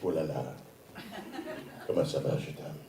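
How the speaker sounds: put-on soft, low French-accented seduction. Imagined French woman seducing him, soft French accent. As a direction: lightly mimic a French lover, hushed. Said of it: "I looked for French women".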